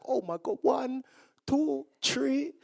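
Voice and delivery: hushed awe, pitch rising on the count; wonder-struck child counting. Retold or imitated. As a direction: count planes like an amazed little kid.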